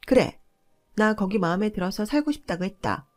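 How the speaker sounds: acted outburst with punched openings; defiant yelling. A narrator's reading. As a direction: snap back loudly with defiance. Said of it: "라며 악을 쓰길래".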